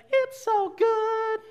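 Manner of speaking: very high, drawn-out mock-happy voice; gushing cheerfulness. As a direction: gush it sky-high and slow, hammed up. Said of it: "the way somebody talks".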